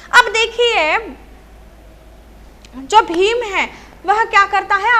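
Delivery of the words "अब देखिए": usual speaking voice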